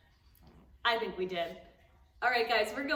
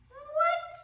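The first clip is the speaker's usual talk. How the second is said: high, squeaky what; hammed-up gasp of surprise; mock surprise; 4 dB louder than usual. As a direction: act amazed for the kids. Vet pulls cat from dog.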